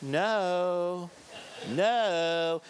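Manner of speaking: mock gentle parenting. Shown as a demonstration. Parent talking to children of this generation, mock-gentle. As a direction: stretch NO out sweetly, no force. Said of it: "that's how you talk to children of this generation".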